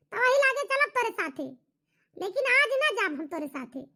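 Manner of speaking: ordinary talk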